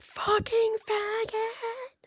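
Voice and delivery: very high, drawn-out mock screech; slow, high-pitched imitation yell; retold scream. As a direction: imitate her screaming down the street, each word stretched. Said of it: "just still screaming out".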